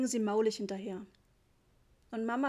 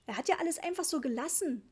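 high-pitched, worked-up protest; hysterical; 6 semitones higher than usual. A narrator's reading. Mother protesting the repairman changed nothing, hysterical. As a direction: wail the complaint with raised pitch. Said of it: "fragte Mama hysterisch".

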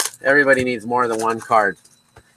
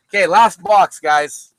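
louder, higher call to viewers; announcing the last box; 5 semitones higher, 4 dB louder than usual. Real, not acted. Opener announcing the last box to stream viewers, louder call. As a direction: hype up the final box.

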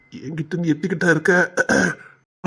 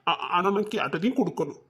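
soft fake whimper; 3 semitones lower, 9 dB quieter than usual. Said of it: "அதான் ராசா அழுது புட்டியல".

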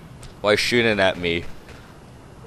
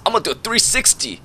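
usual speaking voice

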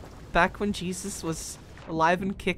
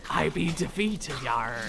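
pirate voice; 5 semitones lower, 4 dB quieter, slower than usual. Acted. Pirate talk continues.

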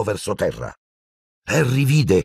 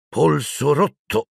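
higher, slow grumbled words; muttered diagnosis; 7 semitones higher, slower than usual. Acted. A lightly voiced teacher mutters over the fallen boy, slow. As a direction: mumble it like a worried teacher. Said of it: "la udì bofonchiare Harry".

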